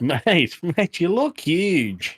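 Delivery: high, slow mock gym bro greeting. Greeting his co-host as a gym bro, high and slow. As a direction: drawl it out like a beefy gym regular impressed by your size.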